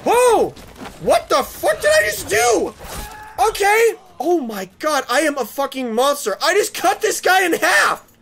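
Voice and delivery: high, loud shocked gamer yelling; raging gamer. Real, not acted. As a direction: yell at the screen in disbelief. Gamer reacting to a brutal kill.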